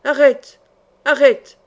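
loud, urgent yell to halt; vociferous shout. A narrator's reading. Burgundians try to stop the knight, vociferous shout. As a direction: shout after a fleeing rider. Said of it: "vociférèrent les Bourguignons".

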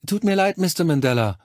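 high, overly cordial apology; übertriebene Herzlichkeit. A narrator's reading. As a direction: lay on fake sympathy as a professional nurse. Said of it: "sagte sie mit übertriebener Herzlichkeit". (übertrieben herzlich, mit hoher Stimme)

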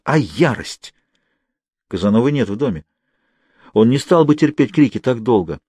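plain reading voice

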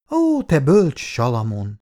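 playful, ironic wife; mocking praise. A narrator's reading. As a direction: tease him with mock admiration. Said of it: "Kár gúnyolódni".

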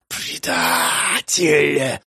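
slow hiss of a lizard creature; hissing demon. Acted. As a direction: hiss it out like a reptile. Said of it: "прошипел кто-то из демонических отродий".

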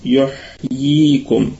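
"يحييكم" drawn out slowly, both ياء sounds clear; careful slow recitation. Shown as a demonstration. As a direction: model "يحييكم" slowly, syllable by syllable. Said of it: "عفوًا".

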